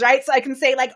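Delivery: ordinary talk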